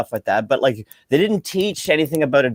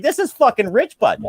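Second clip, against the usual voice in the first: amused mocking; 11 semitones higher, 3 dB louder than usual. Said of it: "I'm laughing my ass off".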